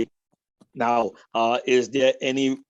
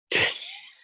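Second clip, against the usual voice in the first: high laugh. Real, not acted. Teller laughs at own joke.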